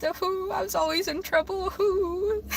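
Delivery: high, soft playful boo-hoo; mock crying. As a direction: whimper it in pretend self-pity, breaking into laughter. Recalls struggling, playfully wails.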